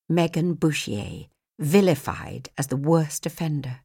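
usual speaking voice

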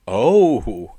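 normal talking voice

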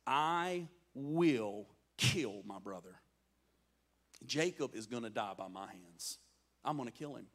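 low, deliberate acted threat; quiet, low-pitched menace; menacing vow. Esau vows to kill his brother.

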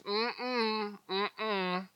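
soft, high hummed mm-mm, waving praise away; humming refusal. Retold or imitated. Mary turning praise away, soft hummed no. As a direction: hum a modest no, quietly. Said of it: "She said".

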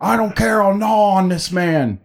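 high, hammed-up madman voice; crazed biter. Acting out a biter threatening onlookers, crazed mock yell. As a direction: rave like a flesh-eating brawler. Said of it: "say something like I just said".

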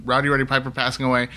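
usual speaking voice

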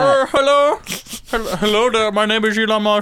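halting, goofy impression; silly slurred caricature voice; Elon Musk. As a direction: do a bumbling foreign-accented billionaire. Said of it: "you sound just like that".

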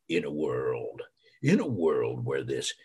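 low whispered trailer voice; low, hushed epic trailer voice; movie trailer voice. Retold or imitated. Imitating Don LaFontaine's trailer line, low and hushed. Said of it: "created the saying".

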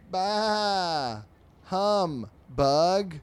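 high, loud bleat of bah humbug. Acted. Sheep answering the fly, loud bleating bah humbug.

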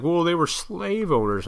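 high, mocking critic voice; lightly mocked critics of the forefathers. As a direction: whine it like a know-it-all. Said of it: "people just wanna".

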